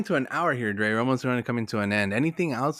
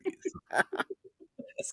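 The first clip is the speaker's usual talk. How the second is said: laughing; 6 dB quieter, much slower than usual. Real, not acted. Laughing echo of quote.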